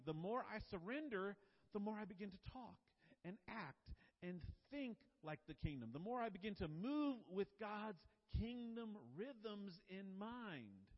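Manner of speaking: preaching at full force. Acted. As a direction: build the sermon up to a loud, rising peak. Preaching builds to climax.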